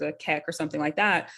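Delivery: ordinary talk, nothing marked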